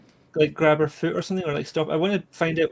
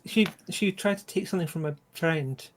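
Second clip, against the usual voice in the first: ordinary talk, nothing marked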